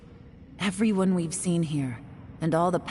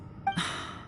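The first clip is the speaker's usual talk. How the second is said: heavy sigh; 8 dB quieter than usual. Acted. Blames self for the trouble.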